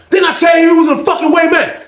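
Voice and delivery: high furious yell; angry shout. Retold or imitated. Old head yelling at OG to move, high shout. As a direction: shout him out of the way.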